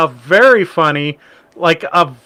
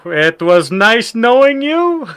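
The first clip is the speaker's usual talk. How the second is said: slow, higher sing-out rising at the end; drawled, pitched-up puppet goodbye; imitated puppet; 5 semitones higher, slower than usual. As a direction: draw it out as a puppet's farewell. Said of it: "had the puppet turn and go".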